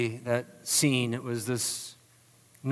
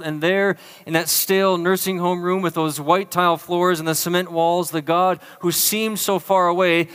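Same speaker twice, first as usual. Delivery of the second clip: loud, high, driving sermon climax; impassioned preaching; 6 semitones higher, 7 dB louder than usual. Real, not acted. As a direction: preach it with full voice, then ease on the last words.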